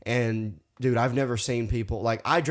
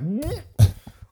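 a sudden high burst of laughter; 12 semitones higher, 5 dB louder than usual. Real, not acted. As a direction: laugh up high at your own story. Laughing at his own story.

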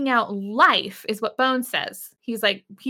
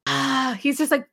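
usual speaking voice

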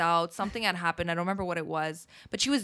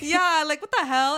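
loud, high indignant outburst; outraged; 7 semitones higher, 6 dB louder than usual. Real, not acted. Reacting to friend's story, loud high indignation.

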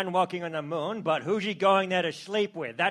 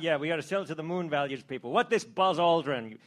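usual speaking voice

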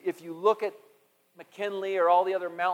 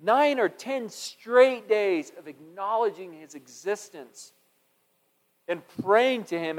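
ordinary talk, nothing marked

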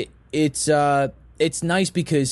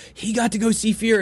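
retold excitement, higher pitch; excited; 7 semitones higher than usual. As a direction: relay someone's excited news. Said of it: "I remember how excited he was".

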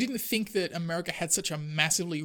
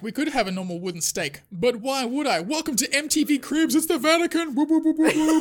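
higher and louder, building to a hyped whoop; boastful Vatican impression; 8 semitones higher, 7 dB louder than usual. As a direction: show off the riches like a TV host, then cheer. Vatican showing off MTV Cribs.

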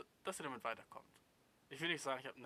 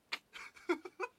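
very high, incredulous laugh; a sharp high laugh of disbelief; 5 dB louder than usual. Real, not acted.

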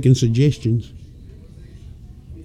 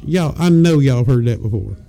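usual speaking voice

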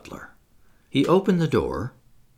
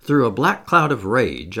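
usual speaking voice

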